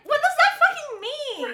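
outraged question. Retold or imitated. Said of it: "And I was like".

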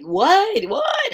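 high, squeaky double what; stunned disbelief. Retold or imitated. Teacher reading a text about Adam's people, stunned and squeaking. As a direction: jump way up in pitch, stunned.

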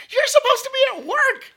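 high scolding mom voice; man doing a woman's voice. Retold or imitated. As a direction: scold like a disapproving mother. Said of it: "pretty good imitation of my mom".

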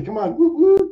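high, loud whooping call; mock rallying cry; playful cheer. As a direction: whoop it up for the crowd. Calls out to the women in chat.

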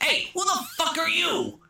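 high mock tough-guy bark; hammed-up gangster snarl; mock Ray Liotta yell. Retelling the movie, Ray Liotta's high mock gangster bark.

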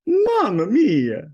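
high, loud, slow Italian exclamation; Italian accent. Acted. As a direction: belt it out like a cartoon Italian. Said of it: "Can you do an Italian accent?".

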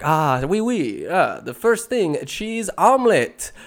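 high, slow mock French accent on the tape voice; a language tape droning French phrases. Tape recorder teaching French in a mock French accent. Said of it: "and it's like".

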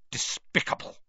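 spat in a voiceless hiss. A narrator's reading. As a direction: spit the word with contempt. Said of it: "Mister Crouch spat".

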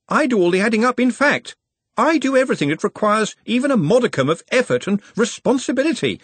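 the overworked clerk finally standing his ground; exasperated young wizard. Acted. Ponder voiced, pushing back.

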